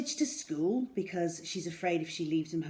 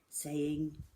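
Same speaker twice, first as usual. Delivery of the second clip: ordinary delivery, nothing marked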